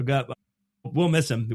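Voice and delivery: ordinary delivery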